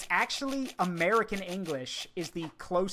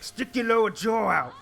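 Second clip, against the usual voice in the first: a hint of a Cockney orc, louder; 4 semitones higher, 7 dB louder than usual. Shown as a demonstration. Describing the orc voice, lightly voiced Cockney orc. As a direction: jut the jaw and push it out like an orc. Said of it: "I think of an orc".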